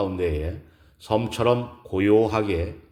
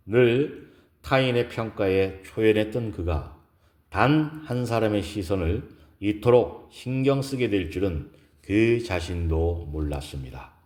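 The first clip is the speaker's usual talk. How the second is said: plain reading voice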